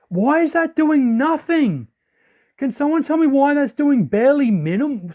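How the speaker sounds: exasperated disbelief. Real, not acted. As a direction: demand an explanation, exasperated. Move does almost no damage.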